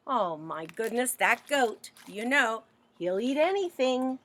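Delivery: ordinary delivery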